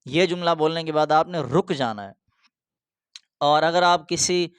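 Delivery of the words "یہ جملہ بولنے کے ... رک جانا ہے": usual speaking voice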